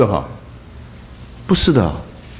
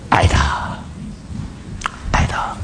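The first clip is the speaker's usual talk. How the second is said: whispered tenderly; 8 dB quieter, slower than usual. Real, not acted. Tender answer why Jesus wept.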